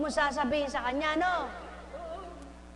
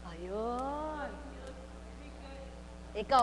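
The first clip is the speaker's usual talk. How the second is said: ordinary talk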